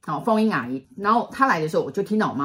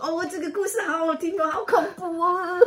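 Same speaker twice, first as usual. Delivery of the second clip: much higher, half-crying mock fright; thrilled scared sister; 11 semitones higher than usual. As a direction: squeal it like a spooked but delighted kid sister. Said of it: "大姐每次的表情就这样".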